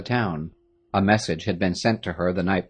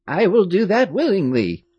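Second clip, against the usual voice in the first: put-on higher fond old woman's voice; a doting old servant, amazed; old woman greeting the girls; old nurse; 9 semitones higher, 4 dB louder than usual. A narrator's reading.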